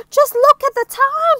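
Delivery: high, very loud exclamation; alarmed cry. Acted. Mister Brave suddenly finding an excuse, alarmed cry. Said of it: "he cried".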